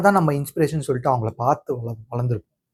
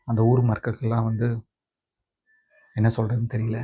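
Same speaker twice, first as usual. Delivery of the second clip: subdued sorrow; 4 dB quieter than usual. Acted. Grandson recounts burying grandmother and villagers stopping him, subdued sorrow. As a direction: hold the grief back, speaking quietly.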